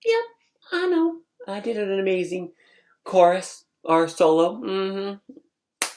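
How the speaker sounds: high-pitched smug agreement; cocky attitude. Retold or imitated. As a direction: toss it back with sassy self-satisfaction.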